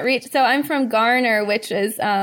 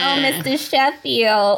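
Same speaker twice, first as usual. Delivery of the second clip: Fran Drescher; 3 semitones higher than usual. Acted. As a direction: imitate a sitcom star's laugh and accent. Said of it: "I would do her accent".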